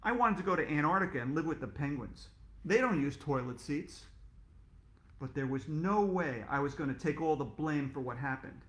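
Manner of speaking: plain reading voice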